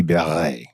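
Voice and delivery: beret said slowly, stressed on the second syllable; French beret. Shown as a demonstration. Slowly explains the French original to the host, beret with a French ending. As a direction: draw out beret, French style. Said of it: "truly in French would be".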